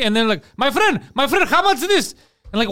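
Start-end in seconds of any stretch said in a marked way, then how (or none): 0.6-2.1 high-pitched hammed-up Greek accent; put-on Greek accent. Friend suddenly haggling with a shopkeeper, mock Greek accent. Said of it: "they'll just change. We're just talking normally".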